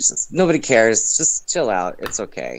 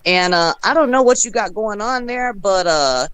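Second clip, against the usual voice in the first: higher-pitched Southern comedian impression; Southern accent; 5 semitones higher than usual. Friend relaying Southern comedian's joke video, Southern accent.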